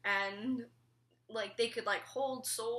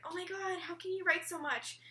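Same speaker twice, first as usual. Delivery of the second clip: higher-pitched imitation of astonished fans; amazed readers; 10 semitones higher than usual. Readers marvelling at the long chapters, imitated in high-pitched amazement. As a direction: gush in mock amazement, pitched up. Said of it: "people would be like".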